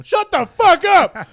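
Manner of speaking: high, loud yell; yelling homeless guy. Retold or imitated. A homeless guy yelling at his singing, loud shout. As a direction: bellow it from the street. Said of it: "homeless guy outside going".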